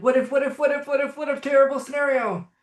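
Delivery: high, rapid-fire what-ifs; acted worst-case worrying; anxious questioning. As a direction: stammer out a mock panicky loop. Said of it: "ask a question like".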